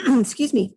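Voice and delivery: loud throat clear. Real, not acted. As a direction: clear the throat, then excuse yourself. Clears throat mid talk.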